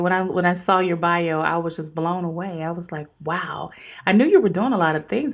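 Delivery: ordinary delivery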